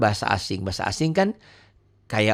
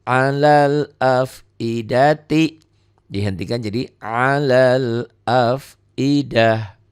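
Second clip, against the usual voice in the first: slow tajwid chant; Quran recitation; 4 dB louder, much slower than usual. Shown as a demonstration. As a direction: draw the words out like a reciter. Recitation example. (lantunan tartil pelan)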